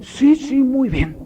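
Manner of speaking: high-pitched imitation of a son; son's voice. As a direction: insist like a kid to dad. Voices son's eager answer.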